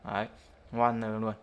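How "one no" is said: "one, no" with the T of two dropped into a long N; nuốt âm t. Shown as a demonstration. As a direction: run "one" into "no", no T at all. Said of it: "Đọc này đấy". (đọc "one, no" nối âm n, bỏ t)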